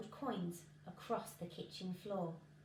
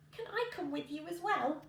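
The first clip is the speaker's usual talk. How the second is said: put-on 1 old woman voice; 6 semitones higher than usual. A narrator's reading. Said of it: "she asked".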